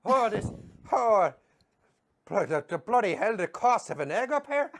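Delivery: high, loud Belfast moaning; Northern Irish accent. Retold or imitated. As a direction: complain like an outraged Belfast tourist. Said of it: "give me a Mickey and Tommy".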